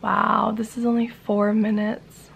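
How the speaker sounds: ordinary delivery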